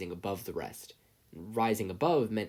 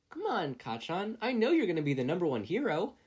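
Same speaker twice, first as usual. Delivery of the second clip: high, eager innocent voice; earnest and guileless, pitched up; innocent boy; 9 semitones higher than usual. A narrator's reading. Izuku reassuring Kacchan, innocent and high. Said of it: "Izuku responded innocently".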